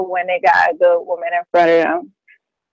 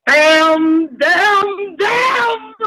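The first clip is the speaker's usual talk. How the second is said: each damn climbing higher in disbelief; rising shocked exclamation; 11 semitones higher, much slower than usual. Real, not acted. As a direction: build from surprise to a shocked cry. Guest reacts to the threat.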